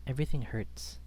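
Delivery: ordinary delivery, nothing marked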